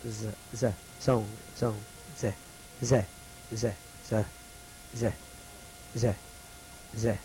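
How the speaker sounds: repeating "ze" softly for the class to copy; drilling the letter sound. Shown as a demonstration. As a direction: model "ze" over and over, quietly. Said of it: "You might try it with me when I say it".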